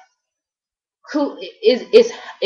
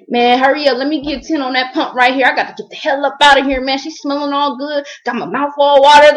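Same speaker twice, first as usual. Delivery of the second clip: higher-pitched, urgent complaining rush; imitating the admirer; 5 semitones higher, 3 dB louder than usual. Retelling a man at the pump desperate to leave, higher urgent imitation. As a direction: hurry it along, building louder to the end. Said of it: "he was like".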